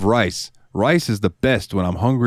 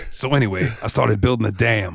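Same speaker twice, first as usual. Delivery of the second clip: Frank; 3 semitones higher than usual. Acted. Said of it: "Danny DeVito's character Frank from It's Always Sunny".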